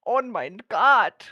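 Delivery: loud, slow, drawn-out German "Oh mein Gott"; big German accent. Pretend. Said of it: "I just like to do the little German accent".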